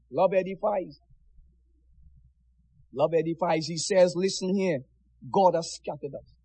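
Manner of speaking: soft, low, grave preaching; hushed solemn. Real, not acted. Preacher quietly pressing the point to brethren, hushed. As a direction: let it fall to a hushed gravity.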